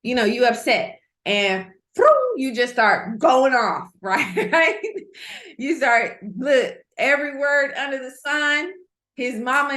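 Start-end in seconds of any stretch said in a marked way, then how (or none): 2.4-8.7 laughing. Real, not acted. Laughing about going off.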